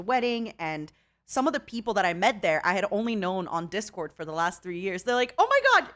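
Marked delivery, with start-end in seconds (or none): 5.4-5.9 high, loud retold fan squeal; excited recognition. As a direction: burst out like a fan meeting someone. Retold fans recognizing her in person.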